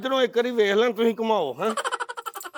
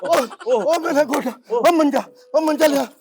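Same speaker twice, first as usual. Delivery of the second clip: crying out in pain; 6 semitones higher, 5 dB louder than usual. Acted. Hit on the knee, crying out.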